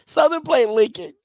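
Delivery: high squealing laugh-talk; wheezing with laughter, can barely speak; helpless laughter. Real, not acted. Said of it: "I'm sorry. I don't know why this is so funny".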